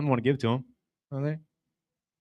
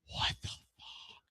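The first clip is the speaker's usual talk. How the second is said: stunned whisper; 19 dB quieter, much slower than usual. Retold or imitated. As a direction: breathe it out in shock. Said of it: "they're like".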